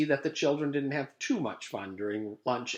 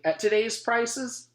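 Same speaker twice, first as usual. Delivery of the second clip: put-on wry kid quip; 11 semitones higher, 4 dB louder, slower than usual. Leslie wry.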